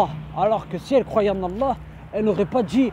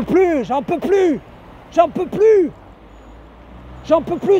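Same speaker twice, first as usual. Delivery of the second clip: higher and louder, bursting out; exasperated shout; 5 semitones higher, 3 dB louder, slower than usual. Real, not acted. Venting at his community, he shouts it out in real exasperation.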